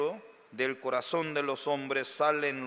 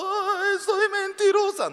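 mock wail; 4 dB louder than usual. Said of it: "nadie estaba llorando".

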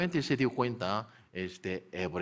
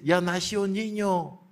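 happy announcement; 8 semitones higher, 4 dB louder than usual. Acted. Announcing the birth joyfully.

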